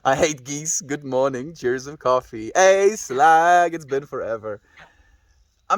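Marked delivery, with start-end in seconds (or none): none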